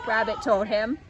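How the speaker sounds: plain reading voice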